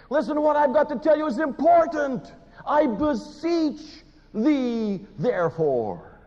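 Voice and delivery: desperate begging apostle; Paul pleading. Retold or imitated. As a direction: plead on one knee, fist clenched. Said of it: "on one knee, clenching his hand".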